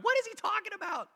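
high-pitched, incredulous crowd reaction; baffled outcry. Acted. Philippian church hearing Paul's letter, high incredulous question. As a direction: blurt it out in mock bewilderment.

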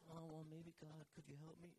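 softly, hesitantly asking God; timid mock plea. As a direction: parody a meek, unsure request. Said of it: "It wasn't".

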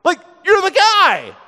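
high-pitched exclamation of sudden realization; acted eureka outburst; dawning realization. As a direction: blurt it out, then stretch it bigger. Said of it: "it dawns on him".

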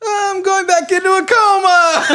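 high, laughing squeak; mock coma patient. Co-host voicing the patient fleeing back into a coma, high and giggly. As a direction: squeak it out through giggles in despair. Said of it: "He's like".

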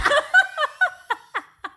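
high, loud burst of laughter. Real, not acted. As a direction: crack up at her own joke. Laughing at own story.

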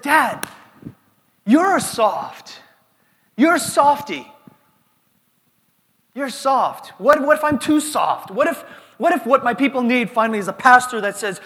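loud, high-pitched exasperated son; exasperated protest. Retold or imitated. Pastor retelling his challenge to his dad, exasperated protest. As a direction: push back loudly, voice jumping up.

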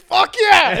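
high, loud whoop of victory; jubilant shout. Retold or imitated. Friends learning the fee will be paid, jubilant shout. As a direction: burst out celebrating at full voice.

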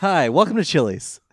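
loud, high chirpy greeter; Vine quote voice. Retold or imitated. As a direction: belt it like a cheerful restaurant greeter. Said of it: "what does he say in that Vine".

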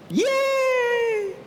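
high, mock-happy yay, dripping with irony; sarcastic cheer. Sarcastic cheer after crushing loss.

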